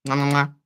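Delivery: playful smacking air kisses; blown kisses. Real, not acted. Reader sending Capricorn viewers kisses, playful. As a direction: blow three kisses to the camera.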